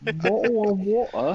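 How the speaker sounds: high British "bottle of water" with dropped t's; British accent. Pretend. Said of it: "You're fucking nailing that".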